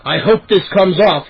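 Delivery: plain reading voice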